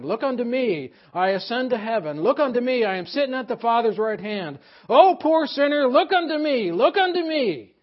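loud, ringing pulpit voice; preaching at full force. Acted. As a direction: preach it out to the hall, building. Preacher quoting a fiery sermon.

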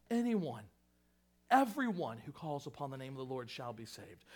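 ordinary talk, nothing marked

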